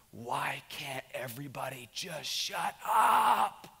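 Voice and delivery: slow, strained mock scream held back in volume; retold scream. Father snapping at his whole family, retold scream. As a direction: drag it out, rising into a strained yell. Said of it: "I screamed at the top of my voice".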